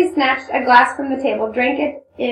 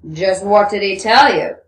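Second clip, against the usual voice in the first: irritated question, pitched down; Rakitin, irritable grumble; 5 dB louder than usual. Acted. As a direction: grumble it out in a lowered man's voice. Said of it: "grumbled irritably".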